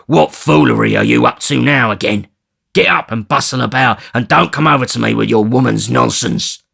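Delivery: loud, harsh scolding; savage. Acted. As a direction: order her about. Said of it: "in a savage voice".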